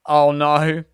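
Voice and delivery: usual speaking voice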